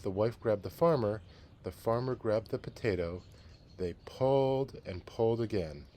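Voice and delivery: lively read-aloud storytelling voice; story narration. A narrator's reading. Teacher reading a picture book to the class, storytelling.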